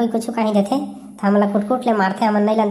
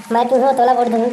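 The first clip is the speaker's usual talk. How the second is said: ordinary delivery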